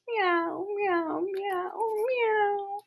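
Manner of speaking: high, soft cat mew; soft meowing. Acted. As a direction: meow gently like a cat. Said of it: "until I said".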